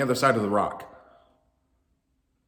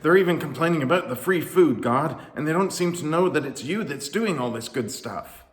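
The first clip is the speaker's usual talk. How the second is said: louder, higher frustrated venting; exasperated complaint; 6 semitones higher, 5 dB louder than usual. A narrator's reading. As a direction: vent your frustration upward. Moses complains to God.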